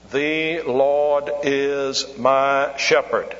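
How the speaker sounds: word by word, very slow and even; plodding child's reading. Retold or imitated. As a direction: read like a boy learning, each word dragged out. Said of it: "that's not the way to read it".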